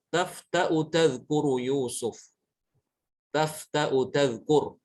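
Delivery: tafta'u tadhkuru Yusuf recited slowly, drawn out; slow Quranic recitation. Shown as a demonstration. Teacher recites the verse for students, slow. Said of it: "Contoh lain".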